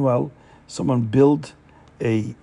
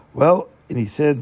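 ordinary delivery

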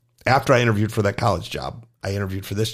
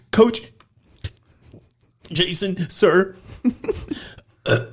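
higher, halting, teary confession; mock-sob through a nervous confession; tearful apology; 5 semitones higher, slower than usual. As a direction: gymnast owning up through tears to her coach. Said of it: "comes out full crocodile tears".

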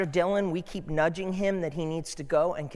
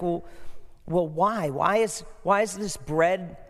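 ordinary delivery

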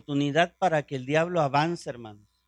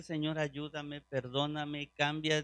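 quiet pleading prayer; 4 semitones lower, 10 dB quieter than usual. Retold or imitated. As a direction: beg the Lord softly, a touch imitated. Imita oración de súplica repetida. (súplica suave y lenta)

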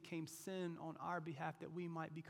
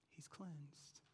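whisper; 12 dB quieter than usual. Acted. Whispered repeat.

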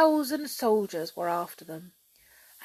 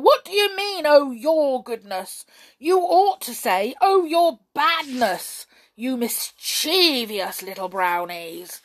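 high, loud, indignant scolding; roaring temper; 5 semitones higher, 9 dB louder than usual. A narrator's reading. King telling off the brownies, in a temper. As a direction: bellow the correction, slowing on the insult. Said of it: "roared the king in a temper".

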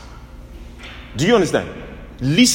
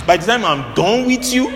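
mock threat; 7 semitones higher than usual. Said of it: "Some people say".

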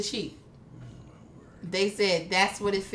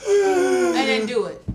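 talking with cheeks sucked in; 7 semitones higher, slower than usual. Shown as a demonstration. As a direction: say it while sucking your cheeks in. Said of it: "Suck your cheeks in".

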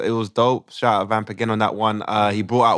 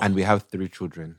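put-on Ugandan accent; 5 semitones lower, 5 dB quieter than usual. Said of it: "we do it in a Ugandan accent".

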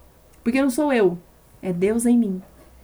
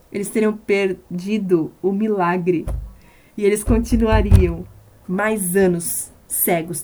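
slow, grave, heavy words; grave, drawn-out pulpit warning; slow solemn warning; slower than usual. Acted. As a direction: let each word land with weight.

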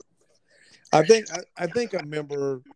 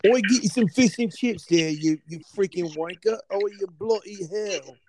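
higher, hammed-up British accent; loud cartoon Brit accent with an indignant Oi; exaggerated, cheeky English accent; mock British accent; 5 semitones higher, 4 dB louder than usual. Shown as a demonstration. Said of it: "Yeah, let's hear your accent".

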